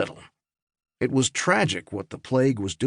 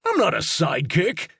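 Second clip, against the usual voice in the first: indignant protest; 8 semitones higher than usual. A narrator's reading. Robot answering the alien major, offended protest. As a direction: insist you are no sidekick. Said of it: "Highbeam cried".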